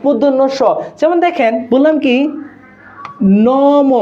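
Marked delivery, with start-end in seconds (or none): 3.5-3.9 "নম" with both vowels held long; slow, deliberate "নম", each syllable sustained; stressed vowel demo. Shown as a demonstration. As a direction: draw out the vowels of "নম" so the class hears them. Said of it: "নম কি উচ্চারণ হইছে?".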